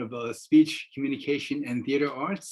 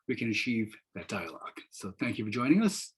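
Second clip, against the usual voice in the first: normal talking voice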